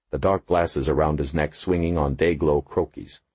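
ordinary delivery

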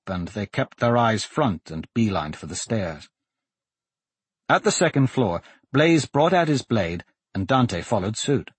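ordinary delivery

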